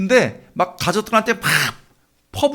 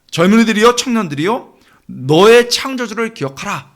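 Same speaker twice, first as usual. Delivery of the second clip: put-on loud, raised-pitch summons to the young; louder, higher preacher's proclamation; proclaiming call; 4 semitones higher, 6 dB louder than usual. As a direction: call out the scripture as a ringing exhortation. Proclaiming scripture's call to youth.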